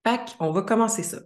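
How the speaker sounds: usual speaking voice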